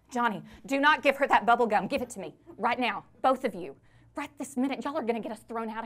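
put-on loud, firm telling-off of the kids; loud scolding. Mother in a visiting room scolds her children over gum, loud and firm. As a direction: snap at them to hand over the gum.

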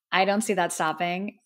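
ordinary delivery

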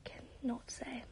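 breathy whisper, half to herself; embarrassed whispered aside. Real, not acted. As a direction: whisper it like a confession.

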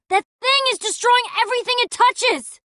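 high, loud alarmed yell; frantic cry of alarm; panicked shout. Acted. Panicked warning during attack.

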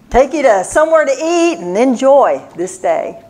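ordinary talk

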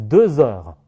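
"deux heures" linked with a z sound, deu-zeures; liaison. Shown as a demonstration. Teacher reads out the liaison result, z linking the words. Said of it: "跟heure就需要进行连诵".

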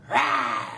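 low playful growl; mock bear growl. Teacher roaring as the woken bear, playful low growl.

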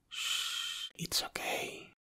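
soothing hush to a crying partner; soothing whisper. Acted. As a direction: shh them gently like calming a child.

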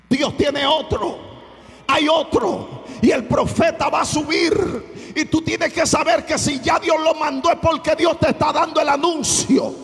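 loud, high-pitched preacher's cry; preaching at full force. Acted. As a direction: preach it with full fire to the congregation. Pastor predicando a toda voz. (predicación a todo pulmón)

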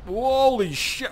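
slow, high amazed holy shit; surprised. Real, not acted. Gamer amazed by a sudden boost, slow high exclaim. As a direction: stretch it out in real amazement.

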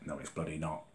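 low bitter mutter; quiet muttered denial. Real, not acted. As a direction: drop the voice to a grumble. Repeats denial, first muttered.

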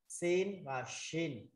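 very slow "sin và shin", each letter name drawn out; contrasting sin and shin. Shown as a demonstration. Teacher correcting students on Arabic letter names, slow contrasting demo. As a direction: stretch "sin", then "shin" with a clear sh sound. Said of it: "Không có phần nào mà đọc nói nó là sin nhỏ và sin lớn".

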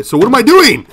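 high, loud exasperated outburst; exasperated yell. Real, not acted. Player lost in the game, exasperated yell. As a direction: shout it high, at a loss.

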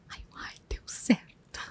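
very soft slow whisper to herself; whispered aside. Real, not acted. Mother relieved her son dislikes the music, slow whisper. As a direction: breathe the relief under your breath.

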